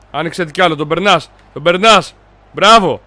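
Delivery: ordinary delivery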